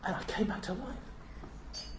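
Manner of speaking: whispered, hushed wonder; awed whisper. Retold or imitated. Lazarus telling the villagers, whispered. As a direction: breathe it out in awe. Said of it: "Lazarus speaking".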